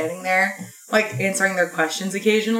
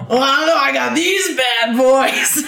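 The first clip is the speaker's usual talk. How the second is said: laughing mock brag; 3 semitones higher than usual. Storyteller mimics a weird customer bragging, laughing. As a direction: ham up the odd customer bragging through a laugh. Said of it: "She's like".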